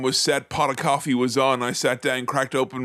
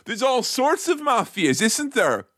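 a light put-on Irish accent, pitched up; 7 semitones higher than usual. Teasing his co-host in a mild Irish accent. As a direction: play up the Irish accent, mildly. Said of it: "I even put on my special accent".

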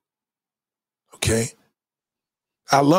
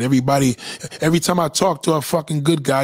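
normal talking voice

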